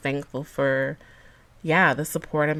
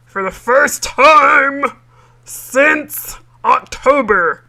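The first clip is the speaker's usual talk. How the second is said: Cookie Monster voice; 8 semitones higher, 11 dB louder, much slower than usual. Pretend. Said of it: "I said it in my Cookie Monster voice".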